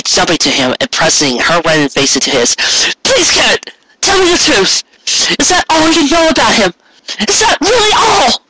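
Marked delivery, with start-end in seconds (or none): 3.0-8.4 very high, strained begging; desperate pleading. A narrator's reading. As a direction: beg him, near tears. Cupcake begs Garrett for truth.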